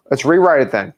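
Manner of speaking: ordinary talk, nothing marked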